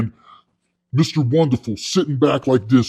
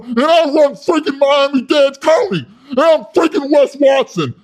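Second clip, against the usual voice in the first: building brag; 7 semitones higher than usual. Retold or imitated. Wes bragging to the sharks, building to a high yelling boast.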